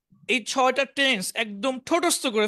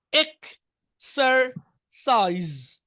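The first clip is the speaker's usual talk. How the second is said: Exercise very slowly, split into three separate beats; teacher's drawn-out dictation of exercise; syllable by syllable; much slower than usual. Shown as a demonstration. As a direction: break exercise into ek, ser, saiz with pauses. Teacher breaks word into syllables.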